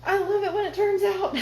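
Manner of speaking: laughing loudly with relief. Real, not acted. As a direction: burst out laughing, high and loud.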